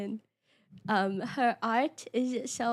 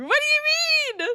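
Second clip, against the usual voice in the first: shocked squeal; 14 semitones higher, 5 dB louder than usual. Real, not acted. Streamer reacts to chat shock.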